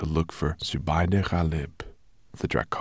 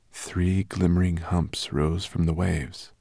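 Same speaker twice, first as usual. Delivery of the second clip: ordinary delivery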